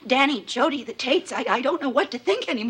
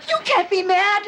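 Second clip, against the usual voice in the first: high-pitched indignant objection; exasperated, high and cross; outraged protest; 8 semitones higher, 4 dB louder than usual. Acted. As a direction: protest it with the voice shooting up. Wife outraged husband is mad.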